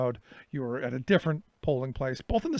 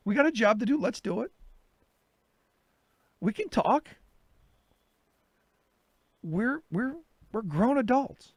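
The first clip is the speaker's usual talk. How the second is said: pleading calm-down; 8 semitones higher than usual. Retold or imitated. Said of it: "And I'm like".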